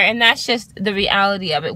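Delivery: ordinary delivery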